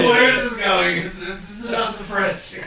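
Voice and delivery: high, loud words through real laughter; laughing. Real, not acted. Host losing it over a French joke, laughing through the words. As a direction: talk while cracking up.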